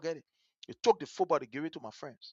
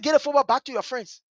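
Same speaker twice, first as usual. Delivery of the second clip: higher, firmer repeat of the order; insistent retold order; 4 semitones higher than usual. Big sister answering his "What?", insistent order. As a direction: repeat it with raised pitch, imitating insistence.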